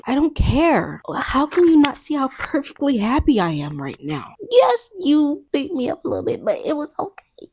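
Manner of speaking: high mock-dreamy girlfriend voice; mock lovestruck Bella. Mocking Bella's devotion to Edward in a high dreamy voice. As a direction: gush like a doting bride. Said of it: "It was basically what she's saying".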